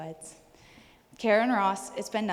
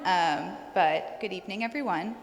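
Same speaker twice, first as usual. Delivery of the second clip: usual speaking voice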